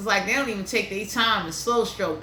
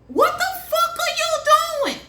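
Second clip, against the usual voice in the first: high, louder exasperated outburst; mock-outraged squeal of disbelief; 4 dB louder than usual. Real, not acted. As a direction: demand an answer in disbelief. Ranting about clumsy lovers.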